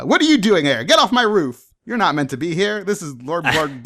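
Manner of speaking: high, loud, startled outburst; shocked shout. Acted. Carriage driver spots a wizard on his roof, shocked shout. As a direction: shout up at the intruder in alarm.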